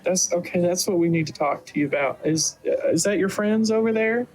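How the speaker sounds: high motherly questioning; doting elven mother. Acted. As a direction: turn from family business to curious mother. Game master plays Rain's mother.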